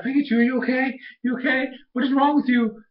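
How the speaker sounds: acting out an alarmed check-in; worried. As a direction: fuss over a hurt little friend. Acted worried check on Pikachu.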